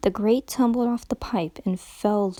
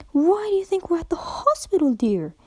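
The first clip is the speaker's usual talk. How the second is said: polite, strained, higher voice; strained politeness; 9 semitones higher than usual. A narrator's reading. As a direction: keep it polite through gritted teeth. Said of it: "as politely as she could manage".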